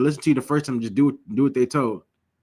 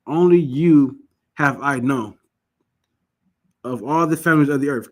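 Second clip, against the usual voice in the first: Only you have I known, drawn out and stressed on Only; slow emphatic scripture quote; 4 dB louder than usual. Shown as a demonstration. Preacher quoting the Most High's words, slow and stressed. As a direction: quote the verse slowly and with weight. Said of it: "The Most High said".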